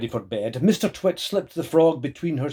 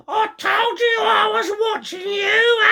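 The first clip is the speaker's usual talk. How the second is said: very high, loud gloating witch voice with laughter; cackling hag; 5 dB louder than usual. Acted. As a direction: crow it like a spiteful old witch. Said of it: "cackled Missus Twit".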